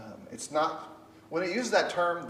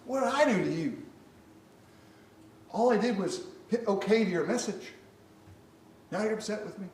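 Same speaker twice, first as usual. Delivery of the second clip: higher, whiny, hurt voice; mock wounded texter; 8 semitones higher than usual. Imitating someone hurt by a short text, high and whiny. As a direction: play the offended friend reading a text. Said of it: "It lands like".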